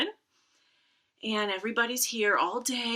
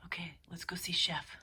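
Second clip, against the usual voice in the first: soft unvoiced whisper; 8 dB quieter than usual. Real, not acted. Librarian tiptoes off to find Chef, whispering. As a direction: sneak off to the next cat. Whispering while moving to cat.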